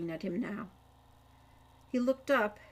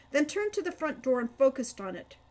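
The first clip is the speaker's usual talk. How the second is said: usual speaking voice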